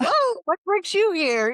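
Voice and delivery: bubbly small talk to strangers at a sex party; chatty socialite. Retold or imitated. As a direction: play the eager party mingler.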